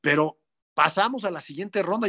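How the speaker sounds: ordinary talk, nothing marked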